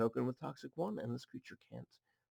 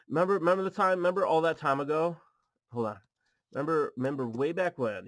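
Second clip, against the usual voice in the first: mock-dramatic callback; 2 semitones higher, 8 dB louder, faster than usual. Mock-dramatic reminiscing to viewers.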